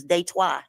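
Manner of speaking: Detroit said as day-TWAH, final t silent; French pronunciation. Shown as a demonstration. Speaker correcting how listeners say the city, French pronunciation of Detroit. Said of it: "That's how you say it in French".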